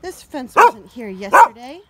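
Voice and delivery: loud, slow, indignant dog voice; dog complains about the new fence, loud and indignant; voicing the dog. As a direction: give the dog an outraged voice. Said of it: "He's like".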